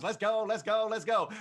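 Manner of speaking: loud, high let's go; rally the bidders with each repeat; excited cheer. Real, not acted. Auctioneer cheers the bidders on, pumped up.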